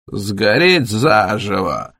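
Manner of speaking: slow, drawn-out croak; labored croaking exclamation. A narrator's reading. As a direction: croak it out slowly, like a sick fireman. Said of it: "прокаркал пожарный и закашлялся".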